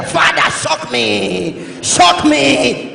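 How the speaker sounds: hammed-up prayer shout, last word stretched long; fervent shouted prayer. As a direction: cry out to God like a congregation. Mimics loud church prayer.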